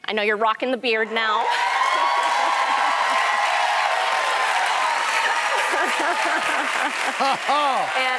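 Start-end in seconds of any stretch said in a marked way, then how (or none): 1.5-7.2 long breathy burst of laughter; delighted laugh. Real, not acted. Host delights at Kings of Comedy gift.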